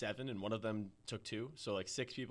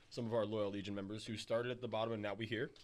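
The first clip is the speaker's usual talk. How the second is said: normal talking voice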